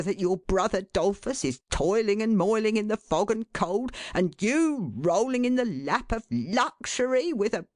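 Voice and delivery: a lightly voiced father, building to an exasperated peak; scolding father. A narrator's reading. Father berating his son after a hard day.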